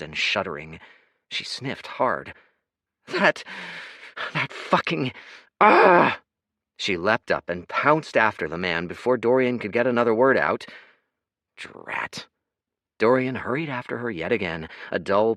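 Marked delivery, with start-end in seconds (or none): 3.1-6.2 high, loud broken outburst ending in a cry; sputtering rage. A narrator's reading. Kaya, tearful after the assault, sputters in fury, high and loud. 11.6-12.3 whispered, exasperated aside; whispered curse. A narrator's reading. Dorian curses under his breath as Kaya runs off again, whispered.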